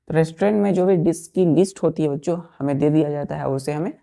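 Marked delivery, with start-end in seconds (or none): none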